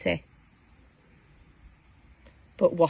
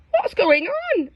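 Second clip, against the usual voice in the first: worried question; 10 semitones higher than usual. Acted. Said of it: "asked Aubergine".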